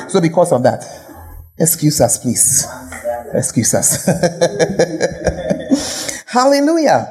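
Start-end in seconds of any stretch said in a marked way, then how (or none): 4.1-6.2 rolling chuckle; laughing. Real, not acted. Preacher laughs after saying excuse us, rolling chuckle. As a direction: break into a run of chuckles.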